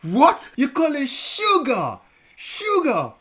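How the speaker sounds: high, loud, incredulous; What? You call it sugar?; shocked disbelief. Real, not acted. Shocked at sugar meaning diabetes.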